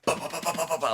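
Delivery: high, rapid-fire "bah" hits; rapid blow noises. Retold or imitated. High guard piling onto a fallen fighter, imitated hits.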